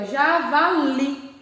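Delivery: "javali" split as ja-va-LI; "javali" drawn out with a strong final LI; stress on LI. Shown as a demonstration. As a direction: sound out "javali" in syllables, leaning on the last. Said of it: "é a sílaba de tom mais forte".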